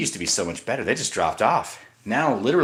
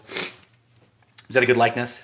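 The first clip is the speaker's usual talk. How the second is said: ordinary delivery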